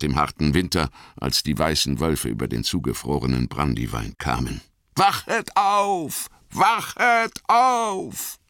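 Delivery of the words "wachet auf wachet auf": very high, slowly drawn-out wake-up call; gedehnter Alarmruf. A narrator's reading. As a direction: sound the alarm like a long horn blast. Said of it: "Von fern hörte man andere Hörner antworten". (hoher, langgezogener Weckruf)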